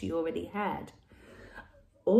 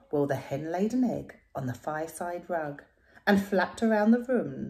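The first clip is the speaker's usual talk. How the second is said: ordinary delivery, nothing marked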